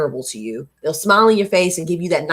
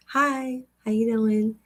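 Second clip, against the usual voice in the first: higher, softer polite hello to a stranger; friendly hello; 4 semitones higher, 7 dB quieter, slower than usual. Retold or imitated. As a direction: chirp a shy friendly greeting on the street. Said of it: "smiling at people and just being like".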